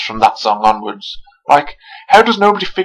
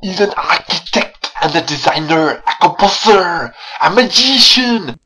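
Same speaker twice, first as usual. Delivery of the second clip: hammed-up French accent, rising higher and slower; mock French accent; 5 semitones higher than usual. Reviewer parroting Madame Giry's line, exaggerated French accent. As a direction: parody a phony French accent, stretching each title. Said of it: "with that phony French accent".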